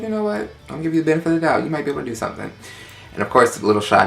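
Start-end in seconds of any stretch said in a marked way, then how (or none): 0.0-2.5 sassy inner voice. Retold or imitated. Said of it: "So I was like".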